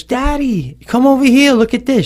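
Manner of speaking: a hint of an excited child calling her dad; little girl calling.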